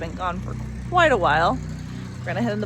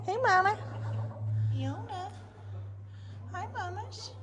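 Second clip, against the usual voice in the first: high, sweet voice for the ewe; talking to a sheep; 12 semitones higher, 6 dB quieter than usual. Real, not acted. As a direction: coo softly at the mother sheep. Greeting the ewe in barn.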